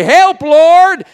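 loud, high plea for help; crying out. Acted. People at wits' end calling on God, crying out. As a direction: throw the cry upward at full voice. Desperate cry to God voiced.

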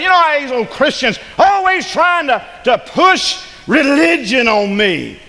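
high, drawn-out imitation of a complainer; mock complaint. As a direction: act out an exasperated objector, stretching the words. Voices an objector complaining about Christians.

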